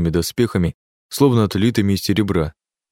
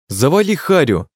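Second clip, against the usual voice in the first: rude, high yell; angry shout; 7 semitones higher, 3 dB louder than usual. A narrator's reading. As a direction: bark it back angrily. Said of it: "выкрикнул я".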